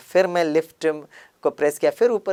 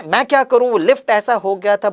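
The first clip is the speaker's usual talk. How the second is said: raised, higher defensive excuse; defensive retort; 6 semitones higher, 5 dB louder than usual. Retold or imitated. Defending himself to the rickshaw driver, raised and heated. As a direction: snap out the lift excuse. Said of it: "जब उसने कहा".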